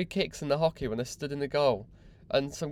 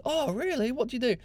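mock impressed listener; 10 semitones higher than usual. Imagined chat with pace rider.

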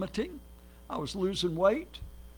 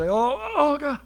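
higher, whiny mock complaint with a sob; mock whining; 4 semitones higher than usual. Preacher mocking people who moan about migraines, mock whine. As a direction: ham up a sufferer moaning about a migraine. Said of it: "And people would say".

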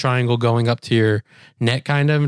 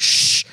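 long hushing "shh"; firm mimed hush; imitated shushing. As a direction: shush someone who is squealing. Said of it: "And they're like".

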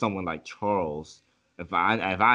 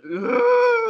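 loud, high exasperated "ugh"; mock disgusted groan; 9 dB louder than usual. Imitating the friend's first reaction, exasperated groan. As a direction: imitate a groan of outrage, pitched way up. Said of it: "your first reaction was like".

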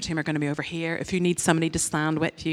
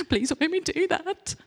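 mock pleading; 12 semitones higher than usual. Pleading with God, voiced.

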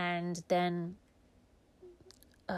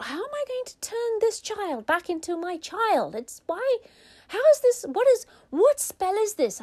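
flustered mum; 12 semitones higher, 6 dB louder than usual. Acted. Mummy's panicked thoughts.

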